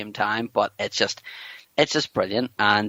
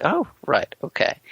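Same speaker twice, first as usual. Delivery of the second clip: mock baffled; 8 semitones higher than usual.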